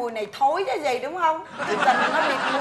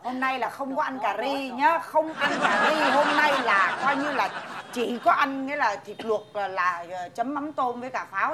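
slow teasing; slower than usual. Acted. Grandma teasing about the meal.

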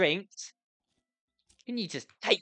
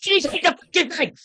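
high-pitched exasperated shout; frantic, strained outburst; stressed yell; 8 semitones higher, 4 dB louder than usual. Real, not acted. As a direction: yell it at the game in frustration. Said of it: "I'm actually that stressed".

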